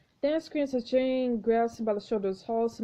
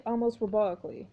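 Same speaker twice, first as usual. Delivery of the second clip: ordinary delivery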